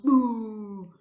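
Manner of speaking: loud, sudden boo to scare the fear away; a loud, jokey spooky boo; playful boo. Shown as a demonstration. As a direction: pounce on it like a boo to a child. Shows how to scare Miedo.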